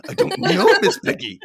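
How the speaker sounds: high frog puppet voice; Kermit voice. Retold or imitated. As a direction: Kermit as Clark Griswold. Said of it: "then I did this impression".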